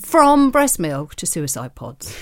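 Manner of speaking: slow, weighty trailer read; announcer tagline. Shown as a demonstration. Co-host reads the tagline slowly like a trailer. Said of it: "life in podcasts with".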